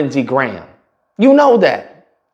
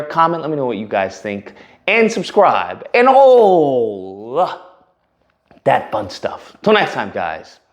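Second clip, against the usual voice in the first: usual speaking voice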